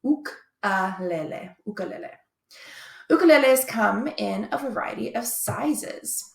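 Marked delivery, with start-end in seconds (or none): 0.0-1.6 "ukulele" slowly in pieces, ook... uh... leh-leh; slow teaching "ukulele", oo-kuh-leh-leh; pronunciation demonstration. Shown as a demonstration. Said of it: "we need to pronounce it correctly". 1.6-2.1 "ukulele" said smoothly as oo-kuh-leh-leh; correct pronunciation. Shown as a demonstration. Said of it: "we need to pronounce it correctly".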